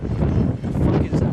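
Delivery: slow stunned what the fuck; baffled mutter; startled. Real, not acted. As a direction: drag it out in disbelief. Reacting to something off camera.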